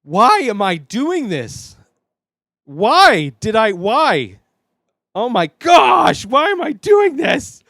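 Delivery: loud, high-pitched cry of regret; panicked wail. Real, not acted. Host staring at the tarantula he must eat, panicked wail. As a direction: wail it in mounting dread.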